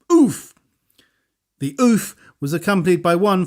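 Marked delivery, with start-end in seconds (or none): 0.1-0.5 loud high oof; effort grunt. A narrator's reading. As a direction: grunt with a big kick. Final kick.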